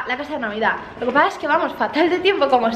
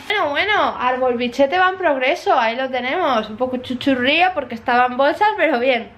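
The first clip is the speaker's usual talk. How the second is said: ordinary talk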